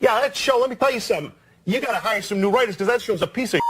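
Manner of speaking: a hint of a tough guy giving notes; Paulie Walnuts advice. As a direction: lean in and deliver it like a mobster. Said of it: "And he says".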